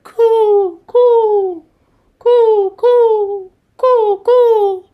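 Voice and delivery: loud, high hooty coos; dove cooing. A narrator's reading. Dove keeping Owl awake, loud high cooing. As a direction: coo high with long vowels, falling away. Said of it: "Dove said".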